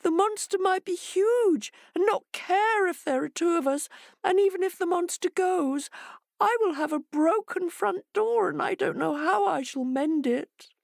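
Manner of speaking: small scared animal pleading; frightened hare. A narrator's reading. Voices Hare.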